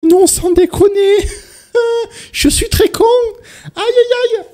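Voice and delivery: very high squeal, loud; squeaky voice. Real, not acted. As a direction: squeal it out in falsetto. High squeaky self-mockery.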